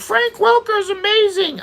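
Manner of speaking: high-pitched fanboy parody; mocking gushing fans. Critic mimicking everyone who praises the voice actor, mocking high voice. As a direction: put on a silly high voice to mimic adoring fans. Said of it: "And everyone's like".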